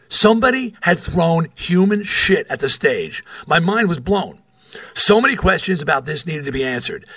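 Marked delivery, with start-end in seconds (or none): none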